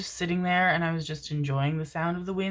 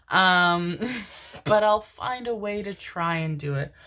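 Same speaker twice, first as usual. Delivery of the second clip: normal talking voice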